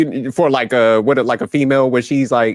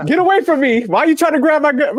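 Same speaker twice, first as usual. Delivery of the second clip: high-pitched mock panic, little extra volume; mock screaming; 14 semitones higher than usual. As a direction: imitate a man shrieking for help in an argument. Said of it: "he gonna start screaming".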